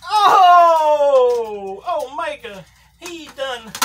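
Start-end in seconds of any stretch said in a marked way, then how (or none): 0.1-1.8 loud shocked yelp; shocked shout. Real, not acted. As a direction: blurt it out in shock. Shocked reaction to a brutal spot.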